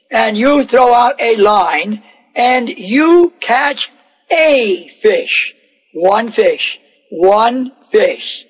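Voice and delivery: slow, emphatic one fish; slow deliberate instruction. Acted. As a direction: spell it out patiently. Jesus instructs slowly, deliberately.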